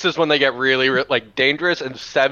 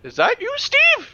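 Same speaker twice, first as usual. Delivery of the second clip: high NPC voice. Acted.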